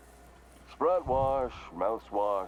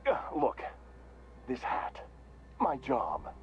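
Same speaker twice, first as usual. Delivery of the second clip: halting, pleading admission; flustered, pained; 3 semitones higher than usual. Acted. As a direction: stumble as the cheerful act cracks. Mascot's act cracks when confronted.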